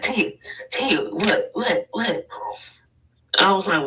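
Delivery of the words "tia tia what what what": high, eager questions; excited. Retold or imitated. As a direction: press her for the gossip. Friend's excited quote.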